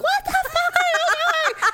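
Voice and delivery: yelling at her husband at the wheel, laughing as she retells it; retold scream. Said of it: "I screamed at him".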